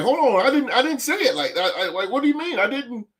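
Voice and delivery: high, laughing protest; a player scrambling to explain himself, laughing; flustered denial. Retold or imitated. Player protesting to the media, flustered and laughing. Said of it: "And you like".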